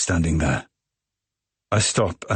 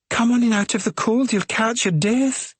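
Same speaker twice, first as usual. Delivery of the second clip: much higher, motherly fussing voice; fussing hostess. Acted. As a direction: usher the guest in from the cold, high and fond. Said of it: "she says".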